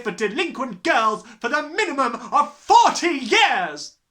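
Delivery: loud, relentless rant; furious tirade. A narrator's reading. Headmistress raging at Matilda, loud. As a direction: rant her out of the school. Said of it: "was shouting".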